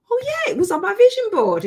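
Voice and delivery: high-pitched delighted recall; excited realization. Retold or imitated. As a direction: act out a sudden happy discovery. Retelling her sudden happy realization.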